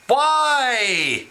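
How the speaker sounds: high, drawn-out falling "why"; dismayed wail. Real, not acted. Vlogger protests at Vegemite like seawater, dismayed wail.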